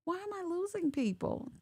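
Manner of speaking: usual speaking voice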